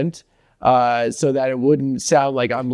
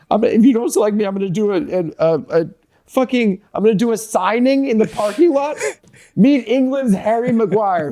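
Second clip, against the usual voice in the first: mock desperate plea, pitched higher throughout; whiny clingy ex; 6 semitones higher than usual. Mocking Maguire to his co-host, higher pleading ex-girlfriend voice. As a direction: beg like a clingy ex who won't let go. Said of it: "acting like such a fucking B P D ex-girlfriend".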